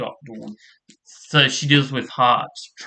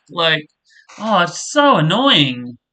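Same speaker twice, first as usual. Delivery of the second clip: ordinary talk, nothing marked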